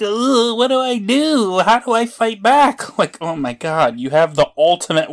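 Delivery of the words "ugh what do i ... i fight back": high-pitched hammed-up despair; mock helpless whine. Mocking hero's helplessness.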